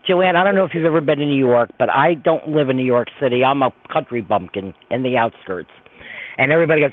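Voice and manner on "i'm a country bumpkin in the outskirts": normal talking voice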